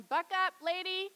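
loud, high hearty coach voice; pep talk mockery. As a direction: bluster like a cheerleader. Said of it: "this is Jesus going".

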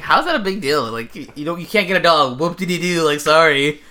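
mock-incredulous, sing out the sarcasm; dismissive mockery. Real, not acted. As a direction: brush it off as no big deal. Mocking the fuss over no pets.

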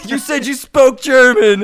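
put-on high, loud German-accented scolding; mock angry German player. German gamer berating the American on voice chat, mock exasperation. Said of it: "They're like".

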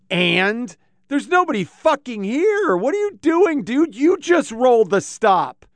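high, pushed retort to the cop; indignant snap at a cop over a rolled stop; exasperated retort. Retold or imitated. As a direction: throw it back at him, fed up. Retold confrontation with cop.

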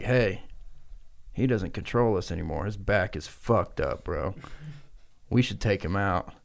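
low, softened plotting voice; conspiratorial. Retold or imitated. One owl plots with another, conspiratorial. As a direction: keep it low and confidential. Owls plotting against Robert.

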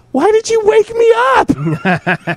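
high, slow whiny complaint; groggy annoyed girlfriend. Retold or imitated. Man imitating girlfriend's annoyed question after waking. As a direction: whine it like she was just woken. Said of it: "she's like".

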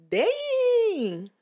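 high, drawn-out stunned reaction; retold shock. Bystander reacting to the publicist's outburst, shocked. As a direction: let it soar in mock shock. Her reaction to the airport row.

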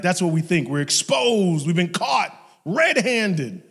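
loud, high cry of being busted; mock-dramatic alarm; alarmed shout. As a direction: shout it out slowly, each word hammered. Voices fear of being exposed.

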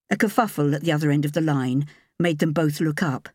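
plain reading voice

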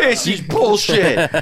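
slow laughing gripe; mock the customer while laughing; laughing impression of the customer's gripe; laughing mock complaint.

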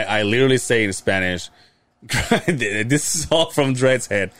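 ordinary talk, nothing marked

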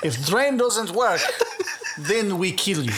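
slow laughing mock Russian; Russian accent. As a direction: laugh through a Russian surgeon voice.

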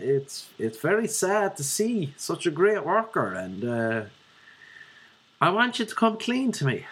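high Dublin head-office boss voice; Dublin accent. Retold or imitated. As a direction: break bad news in a put-on Dublin accent. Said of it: "I could do a Dublin one".

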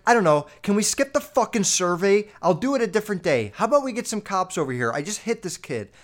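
angry rant at the phone operator; angry retort. Retold or imitated. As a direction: snap back at the dispatcher, fed up. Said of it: "And then I got mad".

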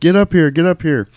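high, loud shout up the ramp; urgent call. Retold or imitated. Pilot yelling for the crewman to get on, loud and high. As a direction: yell for him to hurry aboard.

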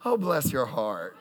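slow, lightly Southern "Oh, bless your heart" with a laugh; a hint of a sweet Southern put-down. Mocking the Virginia and Carolina buyers, lightly Southern and slow. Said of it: "people I typically deal with in Virginia and North Carolina".

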